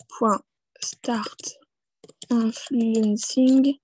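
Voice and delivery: drawn-out words with pauses between; slow typing dictation. Real, not acted. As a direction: say each word as you type it. Spelling out address while typing.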